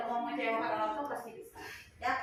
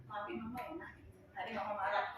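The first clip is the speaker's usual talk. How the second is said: soft, low motherly apology; hushed, soothing retold apology; tender apology; 6 semitones lower, 11 dB quieter, faster than usual. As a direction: say sorry gently, close to the child. Mother apologising to her child.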